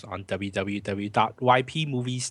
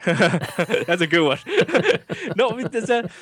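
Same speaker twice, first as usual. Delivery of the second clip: laughing; 8 semitones higher, 5 dB louder than usual. Real, not acted. Laughing at [name] mention.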